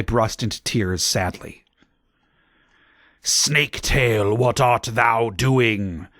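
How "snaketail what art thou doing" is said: slow, archaic villain call; dark lord calling his servant; lightly voiced Voldemort. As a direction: call out slowly and grandly. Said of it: "called Voldemort".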